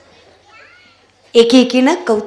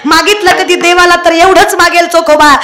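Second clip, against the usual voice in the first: loud, higher fervent praise; preaching at full force; 6 semitones higher, 6 dB louder than usual. Acted. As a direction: pour out praise to the crowd. (तळमळीने जोरात प्रवचन)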